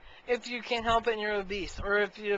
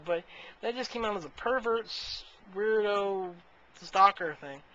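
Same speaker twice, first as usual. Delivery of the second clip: normal talking voice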